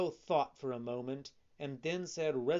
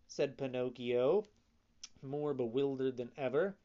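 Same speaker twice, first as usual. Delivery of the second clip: usual speaking voice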